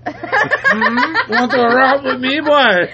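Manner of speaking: high-pitched, giggly mock Jamaican; terrible Jamaican accent. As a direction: squeak out a joking Jamaican accent, laughing. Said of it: "Mine's terrible".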